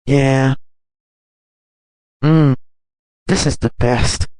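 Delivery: loud, happy mmm of pleasure; delighted with food. Acted. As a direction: savour the pizza out loud. Delighted eating pizza.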